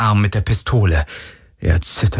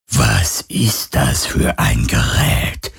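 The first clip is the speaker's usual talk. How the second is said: low, very slow whispered questions; phantom voice; 8 semitones lower, much slower than usual. Acted. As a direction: probe him slowly like a curious ghost. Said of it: "fragte das Phantom".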